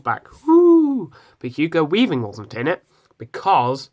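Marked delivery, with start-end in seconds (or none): 0.5-1.1 loud, high whoop of joy; excited cheer. Real, not acted. Cheering Red Skull's return.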